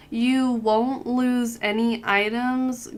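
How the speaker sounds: ordinary delivery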